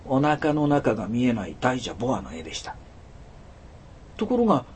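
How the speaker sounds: ordinary delivery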